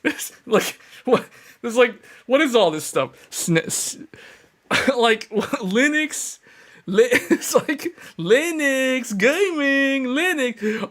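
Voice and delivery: high, giggly put-on confused voice; squeaky mock bewilderment; mock baffled normie. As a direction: laugh through it like a lost newbie. Mocking confused normie reaction.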